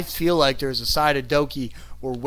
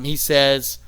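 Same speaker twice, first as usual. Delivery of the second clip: usual speaking voice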